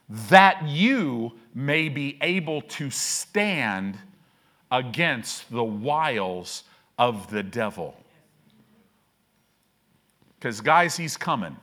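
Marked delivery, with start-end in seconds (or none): 0.1-7.9 each word read slowly and deliberately; slow scripture reading. Shown as a demonstration. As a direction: lay out the verse word by word, slowly. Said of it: "Look at this".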